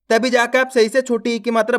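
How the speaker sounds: ordinary talk, nothing marked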